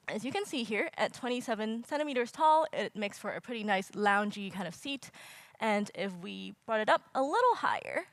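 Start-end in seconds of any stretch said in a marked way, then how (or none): none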